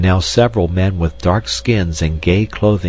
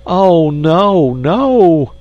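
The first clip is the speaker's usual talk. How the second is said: high, loud desperate cry; agonized cry; 11 semitones higher, 5 dB louder, much slower than usual. A narrator's reading. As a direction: plead in high, loud panic. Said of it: "cried the agonized insect".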